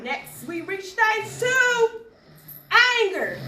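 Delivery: high, slow, drawn-out announcing; declaimed poetic heading; stage announcement. Acted. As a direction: herald the next stage, stretching the words. Announces next stage in poem.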